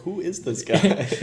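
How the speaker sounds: high, slow, puzzled voice with a laugh; lightly voiced puzzled guy; imitated confused homeowner. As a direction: play a baffled stranger lightly. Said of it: "he's probably like".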